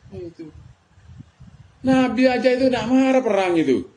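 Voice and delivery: normal talking voice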